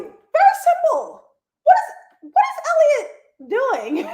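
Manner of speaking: squeaky high mocking voice; shrill mock question. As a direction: pitch it way up in mock disbelief. Voicing Nate mockingly, very high.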